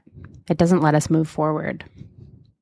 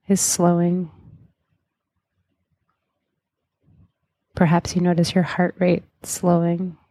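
guided meditation. Acted. Leads a hypnotic guided meditation.

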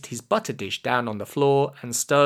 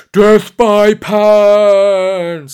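very loud, high, drawn-out war cry; villain's scream; 6 semitones higher, 12 dB louder, much slower than usual. A narrator's reading. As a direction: scream it slowly as a battle cry. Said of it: "screamed Mister Payne".

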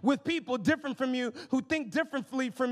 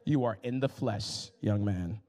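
much lower, laid-back voice of God; deep calm Holy Spirit voice; 12 semitones lower, slower than usual. Retold or imitated. The Holy Spirit calming the eager preacher, low and relaxed. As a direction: talk him down, low and unbothered. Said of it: "He was like".

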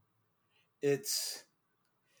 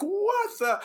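high, loud startled gasp of words; shocked what the; 5 dB louder than usual. Retold or imitated. Judge seeing corps scatter, loud shocked outburst. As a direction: blurt in disbelief. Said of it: "I'm like".

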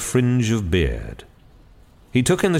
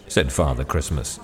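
ordinary delivery

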